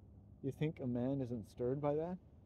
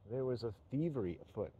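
ordinary delivery

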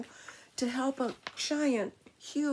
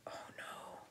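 gasp, then whisper; very soft whispered dismay; hushed alarm; 18 dB quieter than usual. Acted. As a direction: whisper it like bad news. Whale stranded in story.

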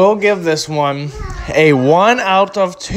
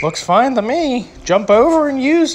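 higher, playful tossed-off advice; cheeky high aside; 9 semitones higher than usual. Pretend. Reviewer urging viewers to hop the stall, playful and higher. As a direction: wink it to the viewers.

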